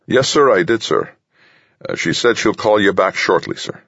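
put-on troubled young captain. A narrator's reading. Young captain reporting to the general over the earpiece, troubled. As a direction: report uneasily to a superior, pitched well up. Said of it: "The young man's voice sounded clearly troubled".

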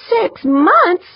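startled. Acted. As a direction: echo it back in shocked disbelief. Startled reply to the estimate.

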